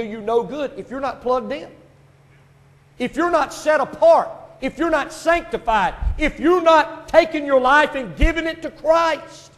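emphatic preaching. Real, not acted. Preacher urging the congregation to be set apart, emphatic. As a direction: preach it hard, drawing out the last clause.

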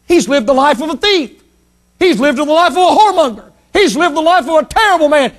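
high, rising mock indignation; scornful accusation. Skeptics condemning the dying thief, high scornful accusation.

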